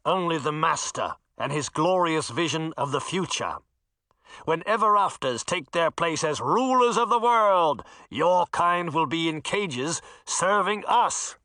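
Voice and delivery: grandiose villain threat; menacing knight. Acted. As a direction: proclaim your master's rule darkly.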